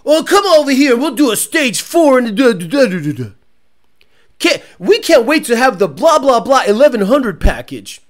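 loud, salesy tuner pitch; hammed-up pushy salesman; mock shady tuner. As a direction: oversell it like a lying shop. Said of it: "tuners online that lie to you".

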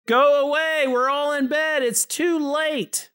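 high, loud, drawn-out shout; yelling back. A narrator's reading. Man in bed yells back at his neighbor, high and drawn out. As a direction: shout them away, voice pitched well up. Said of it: "He yelled back".

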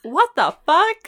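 high, loud, slow disbelief; shocked. Real, not acted. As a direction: drag out the shock. Shocked reaction.

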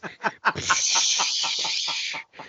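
long hissing "pshhh"; imitated water spray. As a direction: mimic a jet of water with your mouth.

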